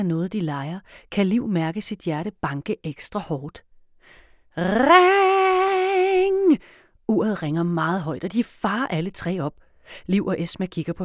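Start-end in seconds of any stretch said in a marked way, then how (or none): 4.6-6.6 alarm ringing. A narrator's reading. Stopwatch alarm goes off.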